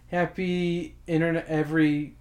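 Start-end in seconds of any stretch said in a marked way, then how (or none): none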